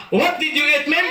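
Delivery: high put-on American question; mock American asking. American man asking what he ate, high put-on accent. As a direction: quiz him casually, American style.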